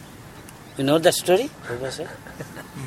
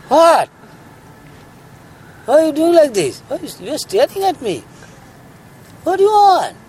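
high, loud startled sage questioning the tiger; alarmed sage; 13 semitones higher, 9 dB louder than usual. Acted. Sage confronts staring tiger.

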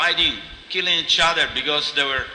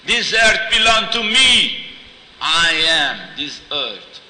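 loud, slow, drawn-out proclamation; commanding goddess; 4 semitones higher, 7 dB louder, slower than usual. Acted. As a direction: declare your ownership like a goddess rebuking kings. Earth goddess rebukes warring kings.

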